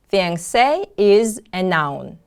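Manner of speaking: ordinary talk, nothing marked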